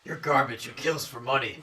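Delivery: growled vigilante voice; Batman. Acted. Confronting criminals at night, Batman growl. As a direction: growl it like a masked crimefighter. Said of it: "in your Batman voice".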